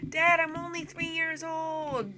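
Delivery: put-on high, drawn-out kid whine; protesting little son. Three-year-old protesting to Dad, high slow whine. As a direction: play a toddler complaining to Dad.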